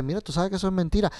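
quick hushed aside; quick mock aside. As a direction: rattle it off like a secret. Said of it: "tú dices".

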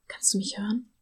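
breathy whisper through a door. Acted. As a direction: whisper so only she hears. Noel speaks through iron door. (geflüstert)

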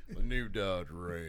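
low, slow truck-ad voice; Sam Elliott. Pretend. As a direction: draw it out deep like a truck commercial. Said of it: "Give us your best Sam Elliott".

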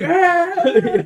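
high, loud mock scream; hammed-up screech through laughter. Retelling a character who starts screaming, comic scream. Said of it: "Just starts screaming".

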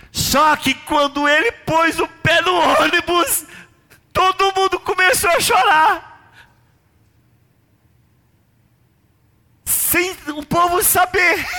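high, loud preaching climax; full-force preaching. Acted. Pastor stirring up the congregation, preaching loud and high. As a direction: build it up like a revival preacher.

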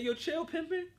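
exaggerated high-voiced reassurance; high-pitched protest. Retelling himself urging her on.

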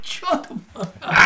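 urgent hushed whisper. Retold or imitated. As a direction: whisper it through your teeth. Hissing at dad.

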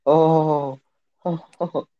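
rising, hyped teasing "oh, oh". Real, not acted. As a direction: rub in the roast. Teasing after a roast.

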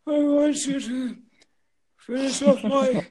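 put-on high, thin old person voice; very old faint voice. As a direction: croak it out like a dying elder. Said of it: "I'm doing an impersonation of a very old person".

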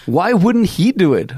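usual speaking voice

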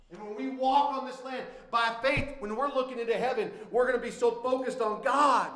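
loud preacher's cadence, building to GOD; impassioned preaching. Real, not acted. Preaches with rising force.

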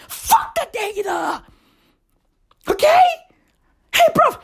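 high strained yell; mock yell. Host ranting against waiting for data, high mock yell. Rant yelled at the listener.

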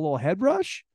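ordinary talk, nothing marked

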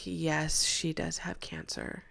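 hushed, slow whisper. Retold or imitated. Mother whispering the answer over the child's head. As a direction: whisper it so the child won't hear.

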